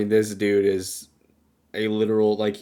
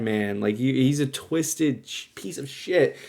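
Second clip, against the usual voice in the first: ordinary delivery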